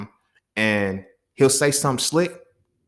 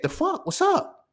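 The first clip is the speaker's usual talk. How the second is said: much higher, mock squeaky taunt; mock squeaky challenge; slower than usual. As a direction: squeak out the dare like a cartoon.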